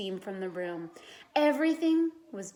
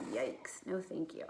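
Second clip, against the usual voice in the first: quiet aside; 4 semitones lower, 9 dB quieter than usual. A narrator's reading. Narrator aside about snake.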